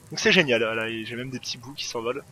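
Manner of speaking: ordinary delivery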